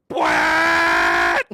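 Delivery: a sudden loud blast standing in for the bike; mock engine roar. Telling a friend about the loud bike, loud roar. Imitating bike noise or cop.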